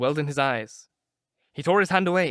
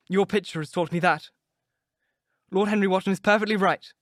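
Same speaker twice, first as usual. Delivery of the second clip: angry; 4 semitones higher than usual. A narrator's reading. Dorian accusing Basil of loving art more than him, angry. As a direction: hurl the hurt back at the painter. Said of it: "He seemed quite angry".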